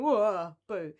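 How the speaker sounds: spooky loud whoa, boo again; hammy spooky ghost wail. Pretend.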